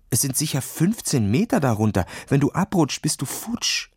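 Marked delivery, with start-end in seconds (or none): 3.0-3.9 whispered warning; frightened whisper to his friend. Acted. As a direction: breathe the words out, scared. Boy warns friend of falling.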